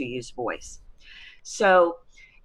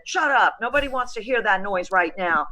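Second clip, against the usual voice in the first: sharp higher-pitched mock scolding; acted maternal telling-off, pitched up; scolding mom; 4 semitones higher than usual. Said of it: "and mom said".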